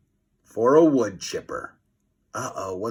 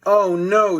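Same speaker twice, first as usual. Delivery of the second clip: high, loud frightened cry; boy seeing the steamroller; scream; 7 semitones higher, 5 dB louder than usual. A narrator's reading. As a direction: scream in panic. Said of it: "screamed Fluffy".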